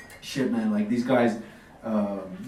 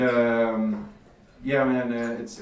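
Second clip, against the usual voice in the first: ordinary delivery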